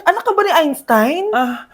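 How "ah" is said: ordinary delivery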